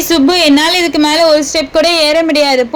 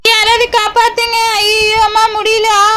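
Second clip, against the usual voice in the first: panicked cries; 3 semitones higher than usual. Acted. Girl falling from the hill begs friends to save her, panicked. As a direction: scream for rescue as you fall. Girl falls crying for help.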